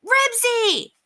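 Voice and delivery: very high-pitched shouted name. A narrator's reading. Henry calls his dog out of a fight, shouting high. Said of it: "shouted Henry".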